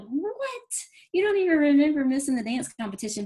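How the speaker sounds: high squeaky "What?" then an incredulous question; a retold gasp of disbelief; shocked disbelief. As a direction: sound floored that she forgot. Shocked at daughter forgetting.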